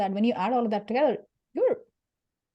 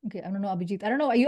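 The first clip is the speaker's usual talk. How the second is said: usual speaking voice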